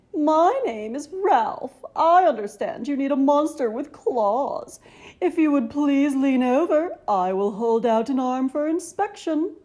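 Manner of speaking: a fussy, refined monster showing off; high silky voice. A narrator's reading. Ralph offers his groomed claws in a high silky voice. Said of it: "he said in a high, silky voice".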